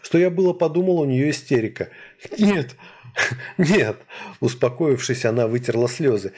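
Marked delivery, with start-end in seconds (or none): 2.4-4.0 put-on higher, laughing girl's voice; acted giggly denial. A narrator's reading. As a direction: get the words out through leftover laughter. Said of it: "смерила меня взглядом и расхохоталась".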